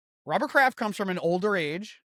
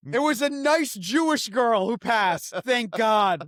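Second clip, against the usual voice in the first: mock messenger; 5 semitones higher, 5 dB louder than usual. Telling the family the news, hammed-up herald. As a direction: proclaim the news like a relieved town crier.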